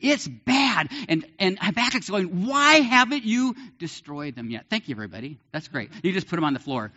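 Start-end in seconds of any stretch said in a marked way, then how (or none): 2.5-4.7 high, pleading complaint to God; pleading prophet. Retold or imitated. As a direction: ask God why, exasperated. Habakkuk voiced asking God.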